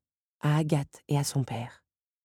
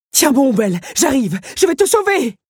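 much higher, loud rescue shout; heroic call; 9 semitones higher, 7 dB louder than usual. Acted. Otto bursts in to save Belle, shouting. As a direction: call out across the room.

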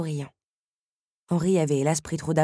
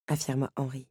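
usual speaking voice

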